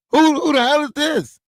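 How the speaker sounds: high-pitched, incredulous mock reply; mock bafflement. Replying to someone confessing undying love, mock bafflement. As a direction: act totally stumped by the caller.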